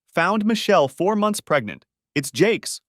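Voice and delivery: high, loud blunt telling; harsh announcement. Acted. Husband telling his friend's wife the affair, harsh. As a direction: drop the news like a blow.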